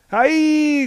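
high, loud gleeful whoop; excited cry. Acted. R T leaping after Prometheus, an excited cry. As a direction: cry it out while jumping. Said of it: "cried R T".

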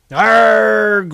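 high, loud roared arg. A narrator's reading. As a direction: let out a furious battle roar. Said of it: "roared Orestes".

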